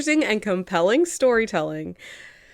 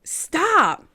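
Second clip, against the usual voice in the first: mock outrage; 12 semitones higher, much slower than usual. Real, not acted.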